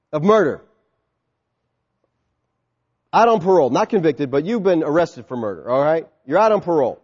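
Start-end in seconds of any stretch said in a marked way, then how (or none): none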